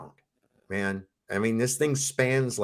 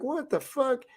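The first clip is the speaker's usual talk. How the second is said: higher, incredulous what the fuck; stunned disbelief; 12 semitones higher, 5 dB quieter than usual. Retold or imitated. Recalling the lump under the kid's skin, shocked disbelief. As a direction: squeak it out in shock.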